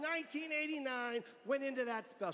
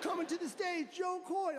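mock announcer; 7 semitones higher than usual.

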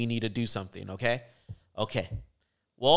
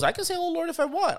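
much higher, fast, indignant comeback; defiant retort; 14 semitones higher, faster than usual. Retold or imitated. Student defending her right to pray, high fast indignant retort. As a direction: snap back like a defiant student. Student talks back to professor.

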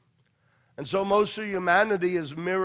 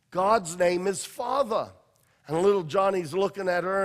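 ordinary delivery

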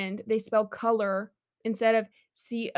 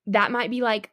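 normal talking voice

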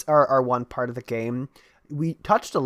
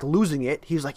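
normal talking voice

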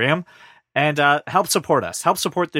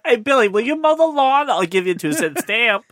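mock neighbor; 8 semitones higher than usual. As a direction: call over to a kid, playfully. Voices neighbor bribing kid.

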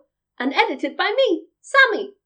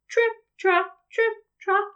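high, crisp little hoof-steps; small goat's hooves in short high words; light tripping patter; 7 semitones higher than usual. A narrator's reading. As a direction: trip along lightly, high and even. Tiny goat's hooves on bridge.

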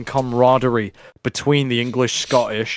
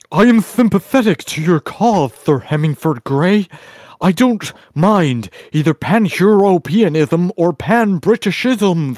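higher, slow, laboured mimicry; Chad; 4 semitones higher than usual. Host acting out Chad pledging sympathy to Hemi's cause, in a slow mock voice. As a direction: imitate him earnestly labouring over the long words. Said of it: "Chad goes".

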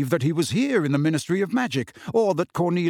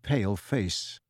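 ordinary delivery

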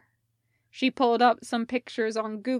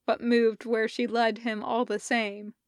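ordinary delivery

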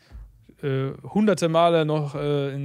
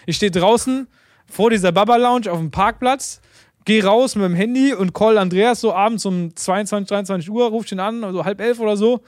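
höher und lauter, langsam und aufgekratzt; aufgeregt; 4 semitones higher, 4 dB louder than usual. Real, not acted. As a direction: die Aufregung von damals wieder spüren. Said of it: "Ungefähr so aufgeregt war ich". (aufgeregt, höher und lauter erzählt)

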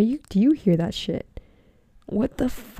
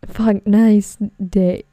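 louder, slow exasperated correction; loud, slow fix; 7 dB louder, much slower than usual. Real, not acted. Fixing her goodbye after a slip, loud and slow. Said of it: "I was gonna say midnight".

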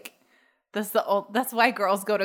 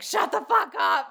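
mock yell; 10 semitones higher than usual. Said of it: "then I'm like".

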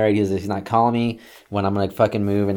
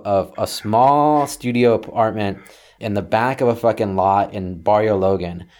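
ordinary delivery

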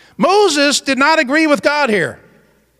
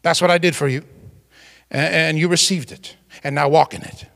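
God's voice; 7 semitones lower, 3 dB quieter than usual. Acted. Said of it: "And God says".

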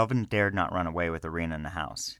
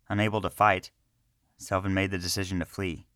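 ordinary delivery, nothing marked

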